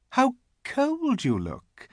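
high, slow, pitying coo; cooing false sympathy. A narrator's reading. The Queen sees he is cold, cooing. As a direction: fuss over him, stretching the words. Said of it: "in a quite different voice".

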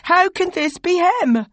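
crying out. Acted. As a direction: cry out in distress. Voiced "you" character objects.

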